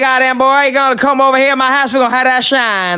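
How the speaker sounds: hammed-up hillbilly voice. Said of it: "how every country person sounds".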